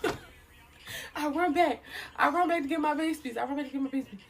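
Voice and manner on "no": long, high drawn-out "no"; wailed no. Retold or imitated. Woman fleeing, told she dropped her charger, wailing refusal. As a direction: stretch it into a comic high wail.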